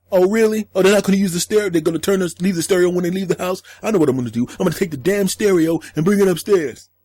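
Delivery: sarcastic high imitation of the dad; mocking the friend. Mocking the friend's plan, higher.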